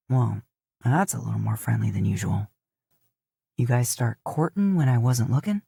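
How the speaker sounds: drawling cowboy joshing his horse; lightly voiced Dean. A narrator's reading. Dean teases his mare and Mato, low and wry.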